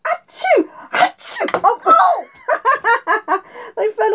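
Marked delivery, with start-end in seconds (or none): 0.1-1.6 high hammed-up sneeze; fake sneeze. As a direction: sneeze big for the kids.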